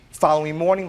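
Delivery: usual speaking voice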